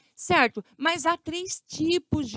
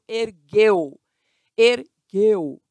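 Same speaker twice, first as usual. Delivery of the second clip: "ergueu" loud, split as er-gueu; oral diphthong demo; 7 dB louder, much slower than usual. Shown as a demonstration. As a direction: sound out "ergueu" firmly, the eu purely through the mouth. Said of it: "emitido exclusivamente pela boca".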